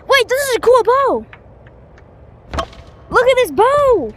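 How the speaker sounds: high, loud thrilled outburst; excited shouting. Real, not acted. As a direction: yell it out, delighted with the loot. Finds a cool new bow.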